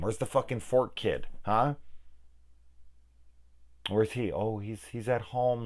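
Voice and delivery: ordinary delivery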